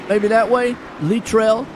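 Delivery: ordinary talk, nothing marked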